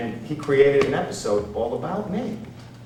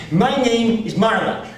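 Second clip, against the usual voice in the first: high, slow, louder character voice; Marla; 8 semitones higher, 4 dB louder, slower than usual. Retold or imitated. Woman backstage introducing herself to the comic, slow high imitation. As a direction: imitate her with slow, raised pitch. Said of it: "a thirty-year-old woman with Down syndrome".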